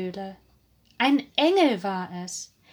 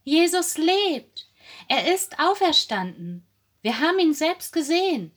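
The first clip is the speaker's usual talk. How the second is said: high, raised storyteller's cry of news; calling out; 8 semitones higher, 4 dB louder than usual. A narrator's reading. Women telling the disciples of the resurrection, calling out. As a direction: proclaim it high and emphatically. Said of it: "riefen die Frauen".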